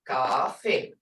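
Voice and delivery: "coffee" as a low gaah-fee; grandkids' pronunciation. Shown as a demonstration. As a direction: say "coffee" with g and long ah. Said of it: "that's how my grandkids say coffee".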